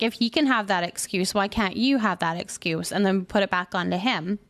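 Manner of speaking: ordinary delivery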